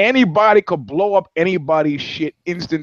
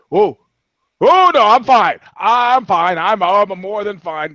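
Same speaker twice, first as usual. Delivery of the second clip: higher and louder nervous insisting; hurried reassurance; 5 semitones higher, 4 dB louder, slower than usual. Retold or imitated. Shooter quickly insists he is fine to the driver. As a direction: blurt out you are fine, fast and scared. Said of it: "the guy finally hears it".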